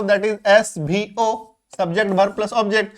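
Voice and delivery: spelled out slowly for students; slow teacher dictation. Shown as a demonstration. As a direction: say each part slowly and clearly. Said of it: "एक basic structure अगर बताऊं".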